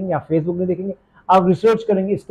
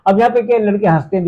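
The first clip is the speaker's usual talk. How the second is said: ordinary talk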